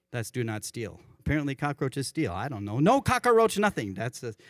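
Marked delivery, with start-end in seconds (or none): 2.8-3.9 "No cockroach nothing" in higher playful Hawaiian Pidgin. Retold or imitated. Pastor repeating the Pidgin line to the congregation, higher Hawaiian Pidgin. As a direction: bounce it out in Pidgin. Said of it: "That's the Hawaiian Pidgin for do not steal".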